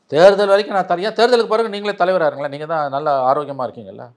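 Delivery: high, loud pleading argument, easing off at the end; insistent acted appeal. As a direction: press the case as if bargaining with a rival. Acts out appeal between the rivals. (வலியுறுத்திக் கெஞ்சும் தொனி, உரத்த குரலில்)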